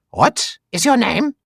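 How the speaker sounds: high, theatrical questioning; mock interrogator. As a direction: play a hammed-up interrogator, drawing out the question. Mock interrogation, comic quote.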